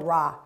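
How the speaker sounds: 라 said short with the tongue touching nothing; Korean ra. Shown as a demonstration. Teacher modelling 라 for students to copy. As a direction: say 라 with a free, lifted tongue. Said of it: "한글로 라를 발음해 보세요".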